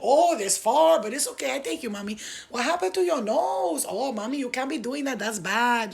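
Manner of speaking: put-on high, exclaiming Dominican-accented fussing; preachy Dominican cabbie. Cab driver preaching about her nose, Dominican accent. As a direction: fuss and scold in a hammed-up Dominican accent. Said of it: "preaching to me the whole time".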